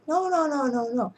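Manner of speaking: panic, then calm down a little; frantic refusal. Retold or imitated. Father frantically refusing to let the doctor circumcise his baby.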